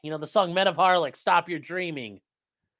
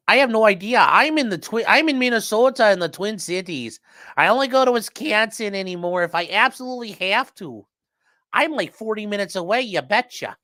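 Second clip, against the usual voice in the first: Minnesotan accent put on, a bit higher and louder; reading a chat message; 4 semitones higher, 5 dB louder than usual. Streamer reading a Minnesotan viewer's chat, mock Minnesota accent. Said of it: "Aaron says".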